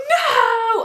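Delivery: high, loud hammed-up crying "no"; crying wail. Storyteller reliving the gunshots at the party, acted crying wail. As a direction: wail it out like mock sobbing. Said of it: "I'm crying".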